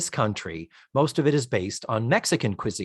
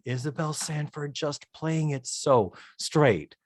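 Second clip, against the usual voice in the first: ordinary delivery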